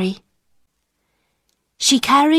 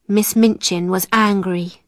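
usual speaking voice

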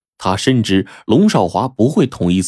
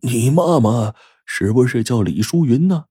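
put-on higher, faltering question from an old man; tremulous, hesitant asking; trembling voice; 4 semitones higher than usual. A narrator's reading. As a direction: stammer slightly on the first word, voice trembling. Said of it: "才颤抖着说".

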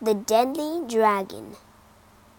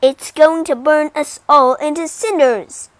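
loud, high alarmed yell; king yelling in alarm; panicked shout; 4 semitones higher, 12 dB louder than usual. Acted. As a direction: shout it in terror.

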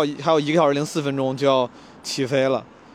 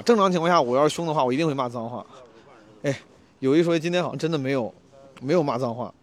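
usual speaking voice

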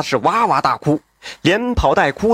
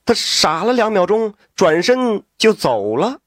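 plain reading voice

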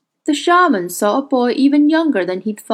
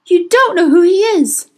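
high, loud, despairing shout; anguished cry; 9 semitones higher, 6 dB louder than usual. A narrator's reading. Will gripping the witch's hair in despair, anguished cry. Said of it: "he cried".

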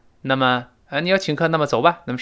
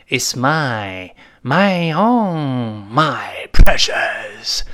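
drawn-out rasping whisper on the last words; covetous creature voice, slowing into a long hiss; creepy possessive croon sinking to a whisper; Lord of the Rings creature; 4 dB louder, much slower than usual. Acted. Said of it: "你看在指环王里面".

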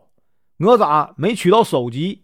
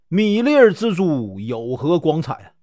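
plain reading voice

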